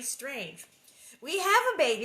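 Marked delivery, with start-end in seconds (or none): 1.2-2.0 high, loud mock protest; protesting mother. A narrator's reading. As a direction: insist loudly, pitch lifted. Ma's protest read again, hammed up.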